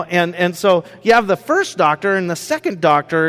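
ordinary talk

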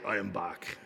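a quick low, soft Terminator line; lightly voiced robot Terminator; Terminator impression. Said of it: "What are you, the Terminator?".

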